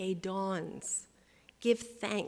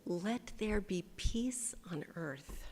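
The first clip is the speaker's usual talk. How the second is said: slow whispered peace on earth; slow whispered closing prayer; 5 dB quieter than usual. Acted. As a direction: hush it slowly as a blessing.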